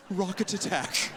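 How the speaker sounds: slow, very proper British announcer; proper British woman. Acted. British woman announcing a rocket attack, slow and proper. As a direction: announce it slowly like a prim British lady. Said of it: "A very proper British woman's voice announces".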